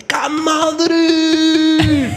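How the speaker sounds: high, drawn-out mock fan yell; rowdy street fan. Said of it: "Un chacal, pero chacal".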